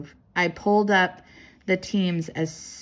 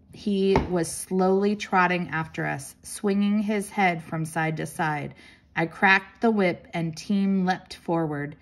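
ordinary delivery

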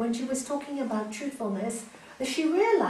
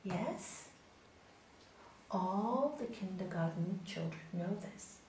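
quiet master; 4 semitones lower, 11 dB quieter than usual. Acted. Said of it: "looks at him and says".

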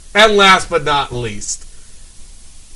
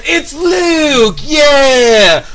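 much higher, loud cheering shout; fan cheering; 9 semitones higher, 6 dB louder, much slower than usual. Retold or imitated. Fan cheering when Luke appears on screen. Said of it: "So I can hear".

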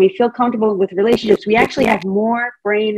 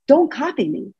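put-on 1 boy copying protest; 5 semitones higher than usual. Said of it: "And he goes".

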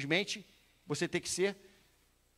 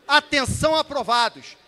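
loud, raised attention call; command voice; 5 semitones higher, 9 dB louder than usual. Shown as a demonstration. Instructor shows candidates how he calls attention, command voice. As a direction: call a whole platoon to attention. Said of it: "Quando eu falo pra você assim".

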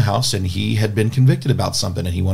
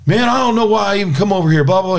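higher and fast, an imitated angry rant; mock-angry rant, quick and raised; retold angry outburst; 10 semitones higher, faster than usual. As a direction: mimic a man storming off in a huff. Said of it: "He gets really mad".

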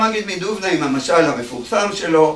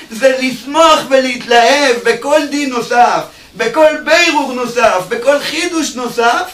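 fiery preaching; 7 semitones higher, 5 dB louder than usual. Acted. Preacher urging joy in Torah study, fiery and loud. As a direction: preach it with full fervor.

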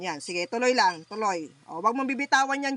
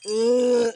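loud groaning grunts; 8 dB louder than usual. Real, not acted. Healer grunting loudly over the patient in trance. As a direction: push out each grunt from the chest.

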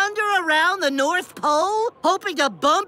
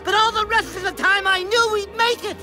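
high, elated gushing; triumphant. Acted. Traveler boasts they would always make it, triumphant. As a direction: keep celebrating through every word. Rejoicing on arrival.

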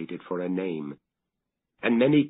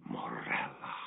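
"Morella." murmured much softer and lower, with a slight rise; murmured name; 8 semitones lower, 10 dB quieter than usual. A narrator's reading. Sea murmuring the dead wife's name, in a soft murmur. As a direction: let the name drift like a ripple on the sea. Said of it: "murmured evermore".

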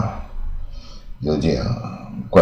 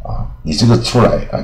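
ordinary talk, nothing marked

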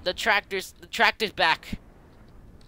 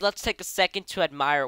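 usual speaking voice

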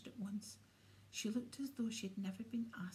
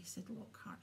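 ordinary delivery, nothing marked

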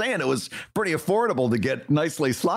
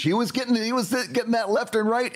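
mock indignant; 6 semitones higher than usual. Mocking the defensive cartoonist.

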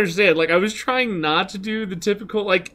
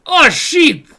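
high, loud yelp of alarm; hammed-up alarmed outburst; mock panicked shout; 10 semitones higher, 9 dB louder than usual. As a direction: yell it as if caught in a raid. Imagined reaction to the raid.

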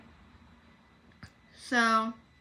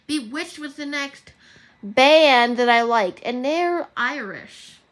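put-on amateur Irish accent, drawn out; put-on Irish accent, badly done; attempted Irish accent; 2 semitones higher, 9 dB louder, slower than usual. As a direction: try a shaky-skilled Irish accent, slowly. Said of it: "I'm terrible at Irish".

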